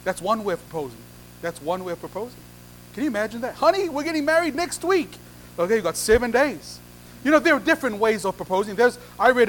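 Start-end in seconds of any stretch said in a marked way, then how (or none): 3.5-5.2 high-pitched, hammed-up news-breaking; excited announcement. Groom telling his honey of the wedding, excited. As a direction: announce it brightly in a raised pitch.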